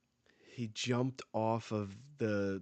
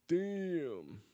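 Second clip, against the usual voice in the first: real shock; 9 semitones higher than usual. Real, not acted. Reacting to the punch.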